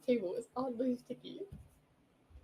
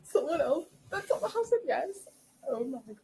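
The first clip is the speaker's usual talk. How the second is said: high, loud, delighted surprise; excited realization; 6 semitones higher, 6 dB louder than usual. Real, not acted. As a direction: jump up in pitch, thrilled. Realizes a guest said yes.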